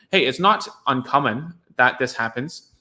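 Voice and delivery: ordinary talk, nothing marked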